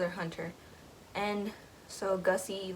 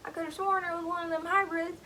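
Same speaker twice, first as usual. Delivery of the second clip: high, quick hunter's voice in a put-on country accent; 10 semitones higher, faster than usual. Hunter talking to his partner over the deer, in a country accent. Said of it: "I give them, like, little country, country accents".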